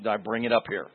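normal talking voice

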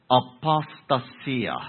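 "apostasia" slowed down, ah-pos-ta-SEE-ah, each syllable laid out; careful pronunciation. Shown as a demonstration. Preacher showing the congregation the Greek form, slow and deliberate. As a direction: draw out "apostasia" slowly and evenly. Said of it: "it's pronounced".

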